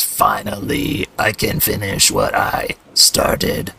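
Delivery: a triumphant villain savouring every word; Noel the villain. Acted. Noel gloating over his robot hordes, slow and menacing. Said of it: "and says".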